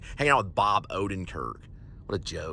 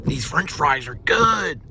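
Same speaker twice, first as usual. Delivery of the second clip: imitating Chris Farley; 8 semitones higher, much slower than usual. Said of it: "at least one joke the guy ever said".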